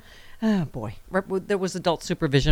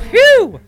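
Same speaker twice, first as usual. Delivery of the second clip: loud, high-pitched exaggerated phew; mock relief; 12 dB louder than usual. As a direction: blow out a big comic sigh of relief. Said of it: "Somewhere Judge Judy's like".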